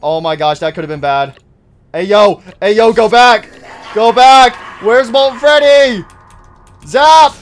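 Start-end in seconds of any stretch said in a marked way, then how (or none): 1.9-7.3 higher and much louder, yelling go back; frantic shouting. Real, not acted. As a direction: bark at the robots to get away. Shouting animatronics away, frantic.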